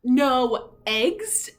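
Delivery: can't believe what he reads; shocked question. Acted. Farmer reading the note, loud and disbelieving.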